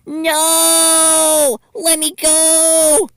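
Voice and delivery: hammed-up terrified shriek; screaming. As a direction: scream as you struggle to break free. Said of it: "Deko screamed bloody murder".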